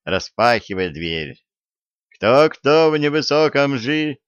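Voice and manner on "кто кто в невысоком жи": весело. A narrator's reading. Продолжает весёлый зачин.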